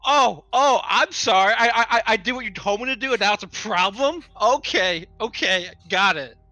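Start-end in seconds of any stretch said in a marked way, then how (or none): none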